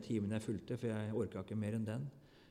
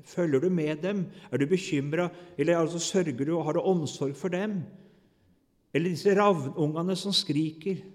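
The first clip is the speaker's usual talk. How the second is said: louder, higher rhetorical questions; forceful questioning; 4 semitones higher, 7 dB louder than usual. Acted. God questioning Job, forceful rhetorical questions. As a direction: press each question on Job.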